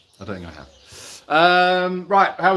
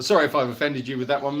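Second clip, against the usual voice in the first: ordinary delivery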